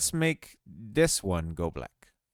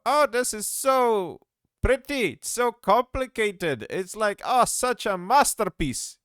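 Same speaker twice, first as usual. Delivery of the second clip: much higher, slow, over-the-top admiring coo; mock gushing; 11 semitones higher, 4 dB louder than usual. Mocking viewers who praise simple shapes, high gushing voice. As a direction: gush sarcastically over a simple drawing. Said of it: "You can't look at this and go".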